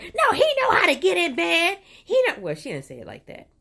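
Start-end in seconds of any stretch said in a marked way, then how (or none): 0.0-2.3 much higher and louder sneering imitation; mock her in a shrill, exaggerated voice. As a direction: friend imitated, mockingly high and loud. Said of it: "that's my voice whenever I'm mocking people".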